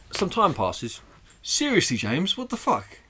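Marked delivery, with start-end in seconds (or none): 1.4-3.0 real disbelief at the noise; shocked. Real, not acted. Reacting to friend's knees cracking.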